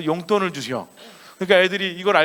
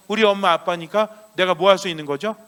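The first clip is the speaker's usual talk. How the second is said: ordinary talk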